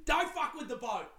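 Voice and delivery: high-pitched comic threat; hammed-up crazed boat owner; mock furious yell. Dripping man warns the thieves, mock angry high cry. Repeats the stranger's warning.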